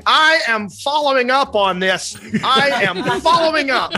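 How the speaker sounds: high, hammed-up Karen yell; mock yelling. Host imitates Karen ending the video, yelling a threat. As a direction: declare it as an indignant, imitated threat. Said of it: "ends with the Karen yelling".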